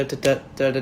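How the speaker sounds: normal talking voice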